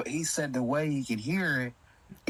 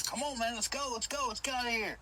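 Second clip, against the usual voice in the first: high, hushed, panicked urging; terrified pleading; 8 semitones higher, 3 dB quieter than usual. Retold or imitated. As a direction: beg your buddies to run, voice way up. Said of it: "He's, like, terrified".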